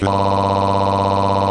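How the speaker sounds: drawn-out fake moan; hammy held moan; mock moan. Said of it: "it will sound a little something like".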